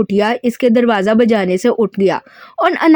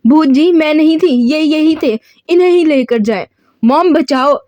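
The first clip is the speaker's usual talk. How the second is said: frightened cry; 5 semitones higher than usual. A narrator's reading. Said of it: "ईमान फौरन से डरती gun छोड़ती बोली".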